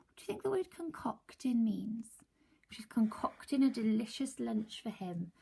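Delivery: whispered question to the class. Real, not acted. Teacher asking class a question, whispered.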